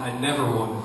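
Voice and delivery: ordinary talk, nothing marked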